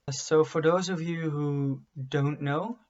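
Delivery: ordinary delivery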